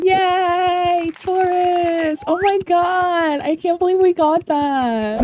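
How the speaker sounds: high, hammed-up squeal of fake surprise; slowed, sing-song fake shock; mock excitement. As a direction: act thrilled at a result everyone saw coming. Mock surprise at an obvious spin.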